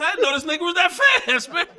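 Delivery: amazed shout. Retold or imitated. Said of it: "I said".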